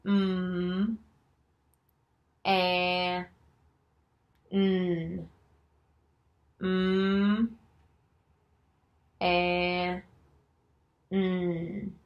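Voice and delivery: each of 'Mm. And. N.' held long and level; separate letter sounds. Shown as a demonstration. Teacher sounding out a word for Jim, isolated held sounds. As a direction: sound out 'Mm. And. N.' one stretched sound at a time. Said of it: "Say the sound".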